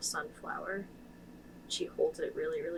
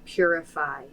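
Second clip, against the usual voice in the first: loud, commanding; loud order; 11 dB louder than usual. Acted. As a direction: insist firmly. Player orders loudly.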